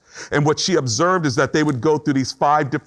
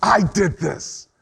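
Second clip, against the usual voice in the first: self blame; 4 semitones higher, much slower than usual. Acted. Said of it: "You're mad at yourself".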